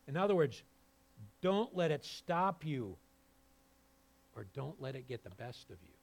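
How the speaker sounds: ordinary delivery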